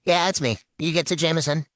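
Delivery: ordinary talk, nothing marked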